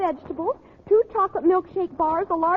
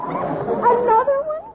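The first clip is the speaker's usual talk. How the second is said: surprised; 7 semitones higher, slower than usual. Acted. Harriet surprised at new frog babies.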